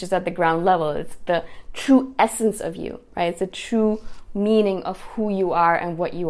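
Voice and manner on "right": usual speaking voice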